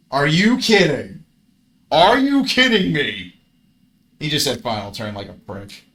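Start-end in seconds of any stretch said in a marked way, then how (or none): 0.1-1.2 loud, slow, drawn out protest; outraged. Real, not acted. Player protesting the opponent's luck, loud and slow. 1.9-3.3 higher, loud, slow cry of disbelief; outraged disbelief. Real, not acted. Player crying out at the opponent's luck, higher and loud.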